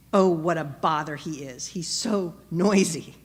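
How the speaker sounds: mock annoyance. Annoyed disciples grumbling about Bartimaeus.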